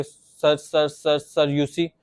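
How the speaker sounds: put-on child stuck on sir; repeating sir. Shown as a demonstration. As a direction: stammer sir several times. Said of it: "वो एक पूरा word उसको repeat कर करके बोलते थे".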